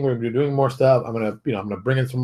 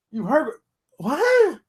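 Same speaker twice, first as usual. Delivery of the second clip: baffled what; much slower than usual. Retold or imitated. Baffled at studio logic.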